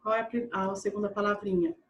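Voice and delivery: ordinary talk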